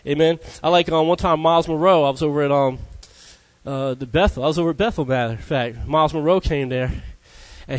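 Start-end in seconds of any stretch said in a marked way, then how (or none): none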